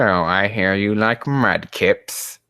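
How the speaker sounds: ordinary talk, nothing marked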